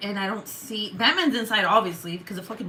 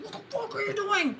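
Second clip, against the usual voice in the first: mock outrage; 13 semitones higher than usual. Owner to runaway cat, high mock scolding. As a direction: imitate a frantic telling-off in a high voice. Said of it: "I'm so fucking mad".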